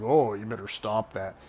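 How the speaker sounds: lightly voiced tipsy stepdad; a hint of boozy scolding; slow-reacting drunk stepdad. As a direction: mimic a drunk man's late reaction. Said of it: "his reactions aren't the best time".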